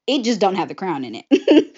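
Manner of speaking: ordinary talk, nothing marked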